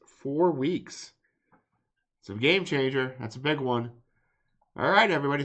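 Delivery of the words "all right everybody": ordinary talk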